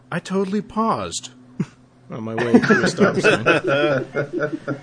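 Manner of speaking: put-on laughing through a valley girl line; lightly voiced valley girl, giggling; Cher quote. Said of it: "the one that I actually quote".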